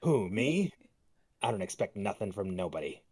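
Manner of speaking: ordinary talk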